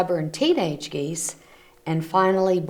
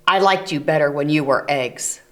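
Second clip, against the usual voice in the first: stern; 3 semitones lower than usual. Acted. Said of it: "Bruce was very stern".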